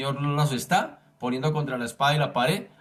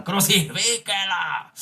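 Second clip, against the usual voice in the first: drawn-out, higher mock shout of a mob; imitated crowd yell; 4 semitones higher, slower than usual. Preacher imitating those who shouted hardest against Jesus, yelling. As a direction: stretch it out like someone yelling in a crowd. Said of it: "tal vez más duro gritaban".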